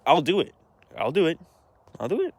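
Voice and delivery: mocking repetition. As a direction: parrot her empty promise with a sarcastic rising lilt. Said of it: "she's like".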